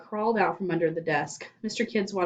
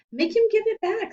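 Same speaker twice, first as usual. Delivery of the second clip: put-on higher, louder kid whine; boy's demand; 5 dB louder than usual. A narrator's reading. Stephen begging Louis, higher and louder. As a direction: demand it like a wronged little kid. Boy demands ball back.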